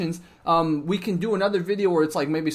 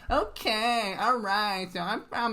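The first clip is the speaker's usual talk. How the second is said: higher-pitched mock Northern California Valley lilt; NorCal Valley accent; 3 semitones higher, 4 dB quieter, slower than usual. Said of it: "I've only met Northern California people".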